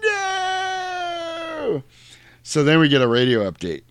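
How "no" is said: high drawn-out crying no; long high mock scream of no; mock wail. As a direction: wail it like a coward. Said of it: "Luther going".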